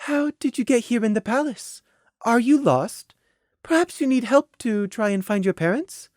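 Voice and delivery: high, soft, concerned lady; kindly. Acted. Mare to a possibly lost child, soft and kindly. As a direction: gently offer a small child help. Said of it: "Saffron Swirl suggested to Prey kindly".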